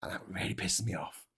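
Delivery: muttered aside. Real, not acted.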